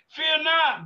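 "Fear not" shouted high and loud; preacher crying out to the prayer line, loud and high; proclaimed. Real, not acted. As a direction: belt out "Fear not" to the congregation. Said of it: "preacher proclaims to the church".

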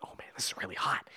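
quick whisper; whispered complaint. Retold or imitated. Ace sweating inside the rhino suit, whispered. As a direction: whisper it fast, overheated. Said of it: "he's like".

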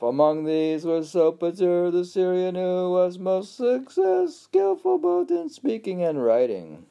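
high, loud chant-like reading, easing down; intoned reading aloud. Acted. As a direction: declaim the old text on a held high note.

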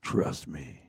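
low, soft, slow delivery; quiet, low-pitched wrestler impression; Jake the Snake Roberts. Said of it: "in the words of Jake the Snake Roberts".

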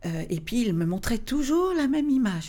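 usual speaking voice

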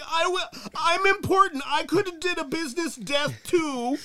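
put-on shrill childish whine at the press conference; whiny high Trump-ish voice. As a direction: play him as a wailing kid wanting credit. Mocking whiny Trump again.

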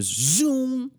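high, drawn-out zoom; high zoom sound; zoom sound effect. Retold or imitated. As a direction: mimic a laser beam firing.

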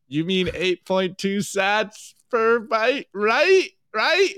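mock anxious plea, pitch rising at the end; worried Padme. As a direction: let the worry creep in as she repeats it. Padme meme, worried repeat.

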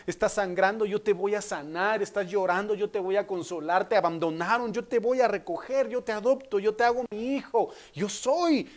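rhythmic promises rising in pitch; fervent voice of God the Father; impassioned preaching. Acted. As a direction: build each promise toward a fervent peak.

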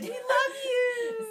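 high, loud, drawn-out gushing; fake adoring fan. Podcaster posing as a random account, squealed fan adoration. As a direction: gush like a made-up superfan. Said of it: "We make up random accounts".

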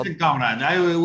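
put-on Russian accent; mock Russian voice. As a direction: play the stock Russian comrade. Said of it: "do a Russian voice".

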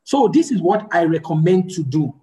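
normal talking voice

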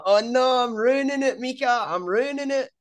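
high-pitched retold panic, half-joking; mock panic. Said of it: "And I was like".

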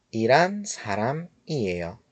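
"ایران سرم ایه یا" slow, dropping on "ایه یا"; falling statement tune. Shown as a demonstration. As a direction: state it slowly, letting "ایه یا" fall at the end. («ایه یا» خبری، با پایان رو به پایین)

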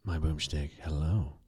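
low, soft copy of a congested voice; imitating sultry sick voice. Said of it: "Ooh, that, that's sultry".

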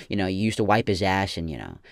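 ordinary talk

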